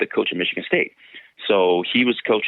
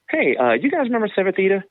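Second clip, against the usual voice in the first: high, casual question to other coaches; casual inquiry; 12 semitones higher than usual. Retold or imitated. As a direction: mimic asking around lightly.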